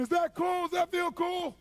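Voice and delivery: high, loud taunting yell; unhinged shrieked interrogation; screaming. Retold or imitated. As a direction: scream the question in their face. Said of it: "I'd be screaming".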